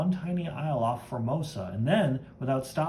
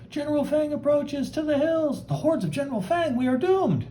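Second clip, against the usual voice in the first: high panicked villager voice; panicked cry; 12 semitones higher than usual. Acted.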